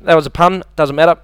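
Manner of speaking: ordinary talk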